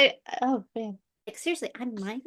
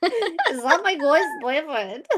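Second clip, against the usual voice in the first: mock protest; 7 semitones higher, 6 dB louder, slower than usual. Real, not acted. Mock protest, slow and loud.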